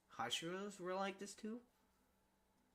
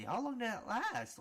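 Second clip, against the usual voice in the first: surprised; 10 semitones higher, 4 dB louder than usual. Real, not acted. Reacting amazed to the fight.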